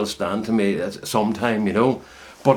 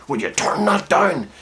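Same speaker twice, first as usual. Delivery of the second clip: held-in anger, higher and slower; a father's anger pressed down; subdued anger; 7 semitones higher than usual. Retold or imitated. Dad to son at two in the morning, held-in anger. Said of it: "that subdued anger".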